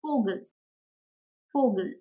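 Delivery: "Vogel" with V said like f; German example word. Shown as a demonstration. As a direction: say "Vogel" as FOH-gel. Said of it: "For example".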